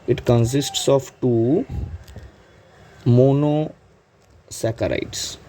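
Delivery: very slow, drawn-out words with a gap before monosaccharides; slow dictation. Real, not acted. Teacher repeating the point for students to note, slow dictation. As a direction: stretch it out syllable by syllable.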